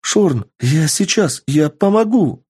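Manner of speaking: high, urgent cry to a friend; elf girl calling out. Acted. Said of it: "кричала эльфийка".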